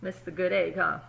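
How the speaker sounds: usual speaking voice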